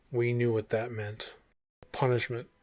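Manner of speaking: ordinary delivery, nothing marked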